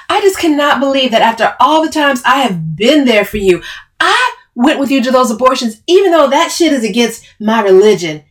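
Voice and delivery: loud, high, hurt outrage; indignant tirade. Acted. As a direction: pile up the favours accusingly.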